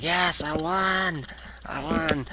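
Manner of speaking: high, drawn-out cheer kept soft; mock victory cry. CM Punk to the crowd after winning, mock triumphant cry. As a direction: celebrate in a hushed mock yell. Acting out a winner's celebration.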